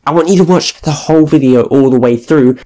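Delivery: loud over-the-top YouTuber plea; hyped YouTuber shout. Pretend. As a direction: yell every ask at the viewer. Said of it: "let's just go full out YouTuber here".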